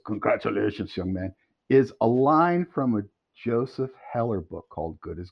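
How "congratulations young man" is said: a hint of an elder statesman; Henry Kissinger. Recalling the statesman's congratulations, lightly imitated. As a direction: lightly imitate a solemn dignitary, dropping lower at the end. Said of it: "when he says".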